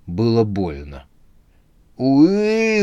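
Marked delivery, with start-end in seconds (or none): 2.0-2.8 high yelp of pain; pained howl. A narrator's reading. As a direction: howl from a smack on the arms. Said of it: "завыл Андрей".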